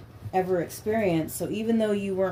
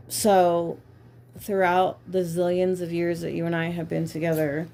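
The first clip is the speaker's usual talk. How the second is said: normal talking voice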